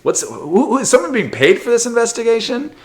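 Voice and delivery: high incredulous question; can't believe it; disbelieving high-pitched rant. Real, not acted.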